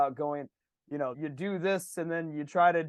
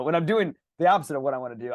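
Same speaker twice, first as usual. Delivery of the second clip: usual speaking voice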